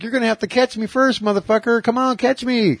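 put-on high, fast cocky teen taunt; bratty taunt. Fourteen-year-old taunting the drunk, high fast bratty taunt. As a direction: needle him like a smart-ass kid. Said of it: "He's like".

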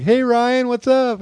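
high, bright-voiced greeting in a retold sketch; mock cheerful greeting. Friend retelling a sketch, high mock greeting. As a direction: chirp it like a goofy pal. Retelling a comedy bit, voicing Patton.

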